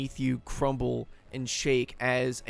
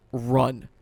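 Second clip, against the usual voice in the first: a short, slightly raised yell; quick yell; 6 dB louder than usual. Acted. Elf to the escaping player, a quick yell. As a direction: call it out as a mild yell. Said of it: "he quickly yells".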